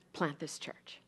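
soft unvoiced whisper of a divine word. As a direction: breathe it out as a hushed holy word. God's voice quoted in whisper.

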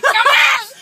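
high, very loud imitation of Comal; mock frightening holler; scary mock shout. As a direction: mimic a friend's scary yell. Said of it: "You make it sound so scary".

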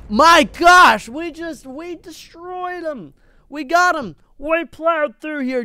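high, slow, drawn-out gushing; gleeful excitement. Real, not acted. Streamer gushing to viewers about strategy, high excited drawl. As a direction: squeal with glee at the win. Bragging about destroying zombies.

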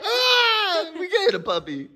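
high, teary squeal of joy; happy crying. Real, not acted. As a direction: cry out with happy tears.

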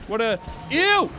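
disgusted shriek. Real, not acted. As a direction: recoil with a high-pitched ew. Disgusted by spindly monster.

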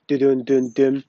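normal talking voice

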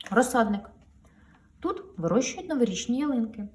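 plain reading voice

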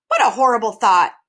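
loud, mildly shuddering bird; a touch of horror. A narrator's reading. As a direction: recoil at the idea. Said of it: "the bird shuddered".

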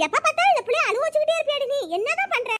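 high, fast mother-in-law scolding. Acted. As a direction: rattle off an exasperated telling-off. Mother-in-law scolds over crying baby.

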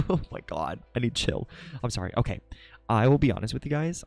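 normal talking voice

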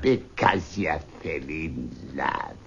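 low, slow, scornful echo; mock his words back at him; sneering mockery. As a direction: Scrooge sneering at his nephew's reason. Scrooge mocks nephew's words.

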